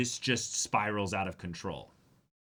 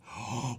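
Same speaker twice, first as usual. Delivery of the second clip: sharp gasp of realization; sudden gasp; 3 semitones higher than usual. Real, not acted. Realizing a plot twist mid-sentence, sharp gasp. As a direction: gasp as the idea hits. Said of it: "wait, no".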